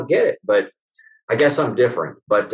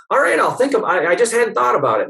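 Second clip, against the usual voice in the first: much higher, stammering excuse; flustered patient; 10 semitones higher than usual. Retold or imitated. Patient caught off guard by her chiropractor, flustered and high. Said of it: "And she said".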